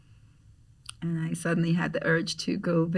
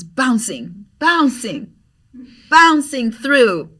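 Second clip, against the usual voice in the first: building, louder and higher with each bouncing; excited bouncing; 6 semitones higher, 10 dB louder, much slower than usual. Retold or imitated. Telling a friend how he bounced in, rising delight. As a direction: stretch bouncing out slowly with glee.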